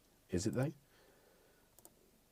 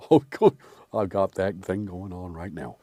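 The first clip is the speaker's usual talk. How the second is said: low, put-on character voice; Delmer; 5 semitones lower than usual. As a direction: keep up the mock character, pitched down. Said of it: "I feel like Delmer right now".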